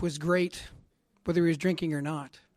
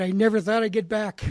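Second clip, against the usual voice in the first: louder, higher, NEVER pressed hard; emphatic quote; 5 semitones higher, 8 dB louder than usual. Retold or imitated. Father at the AA meeting, emphatic and moved. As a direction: push the line out with feeling. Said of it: "He said".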